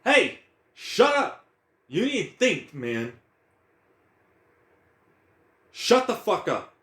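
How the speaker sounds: high, loud, slow order to shut up; self-scolding shout. Acted. Telling his own mind to shut up, a high loud scold. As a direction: bark at your own racing mind.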